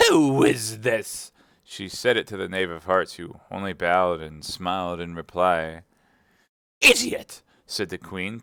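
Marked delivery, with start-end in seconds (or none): 0.1-1.3 loud, slow, higher-pitched demand; severe queen. Acted. Queen questioning the Knave about a stranger, severe. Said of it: "the queen said severely". 6.8-7.4 high, loud, impatient snap; impatient queen. Acted. Queen scolding the Knave of Hearts, loud and impatient. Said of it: "tossing her head impatiently".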